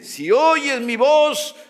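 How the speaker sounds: high, loud and slow, proclaiming; booming voice of God. Acted. Preacher booms God's words to the congregation, loud and slow. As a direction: call it out like God speaking from heaven.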